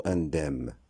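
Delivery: indemne ending in M only, in-dèm; wrong pronunciation. Shown as a demonstration. Teacher showing a second mistake, indemne as in-dèm. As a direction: drop the N from indemne. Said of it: "Ce mot ne se prononcera pas".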